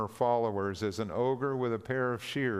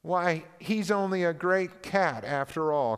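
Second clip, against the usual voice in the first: jeering enemy; 6 semitones higher, 3 dB louder than usual. A narrator's reading. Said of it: "cried one".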